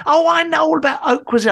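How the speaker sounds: imitating Kim. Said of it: "Kim, you don't".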